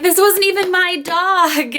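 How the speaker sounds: high, drawn-out wailing complaint; slow tearful protest. Retold or imitated. Mother protesting to staff that the dog wasn't hers, slow high wail. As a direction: stretch it out like a sob. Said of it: "I'm like".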